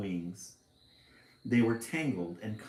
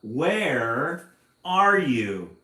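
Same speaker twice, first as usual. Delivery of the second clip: long drawn-out shout, much louder and higher; slow, booming call into the field; loudest shout; 5 semitones higher, 12 dB louder, much slower than usual. A narrator's reading. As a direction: call out across the field as loud as you can. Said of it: "shouted in her loudest, loudest voice".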